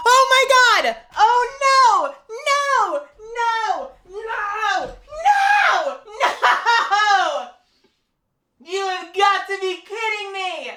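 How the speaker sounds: real shock, laughing as you protest; shocked disbelief. Real, not acted. Shocked by returning character.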